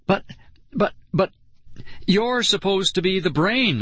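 high, stammered protest; flustered sputter, pitch up. A narrator's reading. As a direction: stammer with gaps, then protest. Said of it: "I sputtered".

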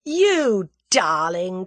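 loud, drawn-out adoring cry; overjoyed, lingering endearment; delighted cry. A narrator's reading. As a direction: cry it slowly with joy. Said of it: "cried Tommy".

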